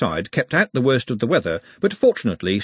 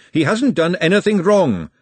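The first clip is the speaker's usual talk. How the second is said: moderately raised, high protest; indignant cry; 7 semitones higher, 4 dB louder than usual. A narrator's reading. Glenda defends Nut from the sisters, indignant cry. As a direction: protest hotly on a friend's behalf. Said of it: "she screamed".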